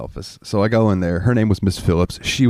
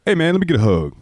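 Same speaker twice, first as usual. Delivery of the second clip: friendly manager; 10 semitones higher than usual. Retold or imitated. Imitating manager Tommy.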